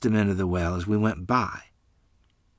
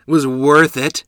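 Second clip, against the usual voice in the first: a touch of happy pride, louder; happily; 4 semitones higher, 8 dB louder than usual. Acted. Boy bragging to friend about the goose pecks, happy. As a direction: grin through it, pleased with yourself.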